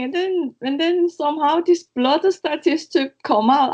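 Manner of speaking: normal talking voice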